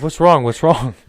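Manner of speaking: mimicking nurse. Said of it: "And she's like".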